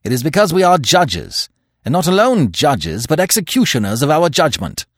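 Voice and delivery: forceful judge's severity; harsh. A narrator's reading. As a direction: declare it severely, pitch up. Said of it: "said Manfred harshly".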